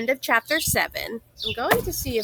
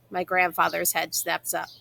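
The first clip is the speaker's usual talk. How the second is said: ordinary delivery, nothing marked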